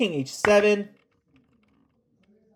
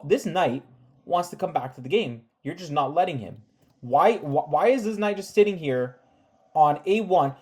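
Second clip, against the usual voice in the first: ordinary delivery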